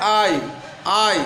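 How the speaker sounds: ordinary talk, nothing marked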